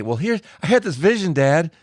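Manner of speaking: high, sing-song pretend line to dad; mock explanation. Host teasing what she could have told her dad, high mocking voice. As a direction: play it as a silly, lightly voiced excuse. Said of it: "you can't just say".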